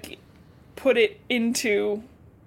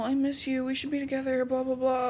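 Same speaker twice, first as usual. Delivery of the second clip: soft, higher-pitched whiny imitation; mock lovesick; 3 semitones higher, 5 dB quieter than usual. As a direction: breeze through a put-on pining voice. Mocks John's lovesick phone talk.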